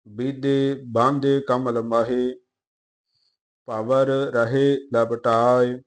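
slow, measured Gurbani reading; even, deliberate verse reading; scripture recitation. Acted. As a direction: recite line by line with drawn-out vowels.

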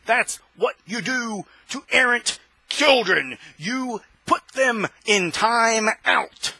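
slow, deliberate, leaning on them; slow scolding. Real, not acted. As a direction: spell it out slowly like a stern parent. Scolding errant children, repeated.

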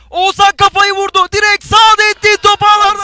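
high, loud goal scream; screaming football commentator. Real, not acted. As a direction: yell the goal as it goes in. Goal call in live commentary.

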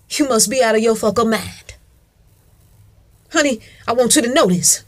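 normal talking voice

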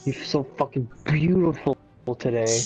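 ordinary talk, nothing marked

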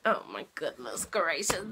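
usual speaking voice

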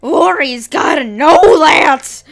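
gasp, then loud high-pitched disbelief; hammed-up shock, drawn out and loud; scandalized schoolmate, way over the top; shocked outcry. Retold shocked reaction, acted out.

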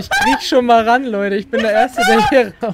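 laughing shout. Real, not acted. Laughing chaos in game.

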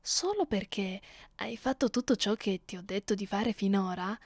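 usual speaking voice

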